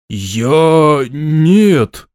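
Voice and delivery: halting, forced-out answer. A narrator's reading. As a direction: squeeze the words out reluctantly. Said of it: "выдавил из себя Шемякин".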